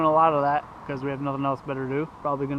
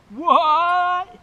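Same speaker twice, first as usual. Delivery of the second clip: loud, high startled what; surprised shout; 11 semitones higher, 11 dB louder than usual. Real, not acted.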